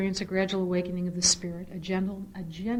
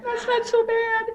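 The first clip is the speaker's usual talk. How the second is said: much higher, louder crying protest; tearful defiance; 14 semitones higher, 12 dB louder than usual. Real, not acted. Mother tearfully defending son to brother Nick, crying. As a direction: plead through laughing sobs.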